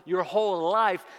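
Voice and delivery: ringing preacher's shout, building to the end; calling out. Acted. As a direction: call it out as God's announcement to everyone. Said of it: "And it's calling out".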